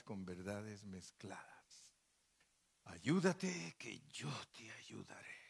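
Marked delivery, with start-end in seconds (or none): 2.8-5.4 slow whisper of the proverb; hushed, slow whispered proverb; whispered saying. Acted. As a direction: breathe it out like a secret. Quoting a popular saying.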